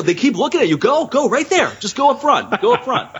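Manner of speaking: high, excited egging-on; mock urging. Man tricking drunk girl into going up front, mock urging.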